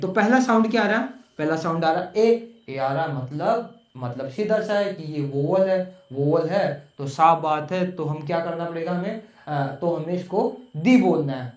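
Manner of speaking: ordinary delivery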